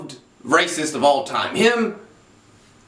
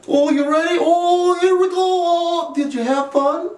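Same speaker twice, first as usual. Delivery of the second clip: goofy dad voice; 12 semitones higher than usual. Retold or imitated. Dad play-wrestling his four-year-old son, high cooing baby talk. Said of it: "a dad try wrestling moves".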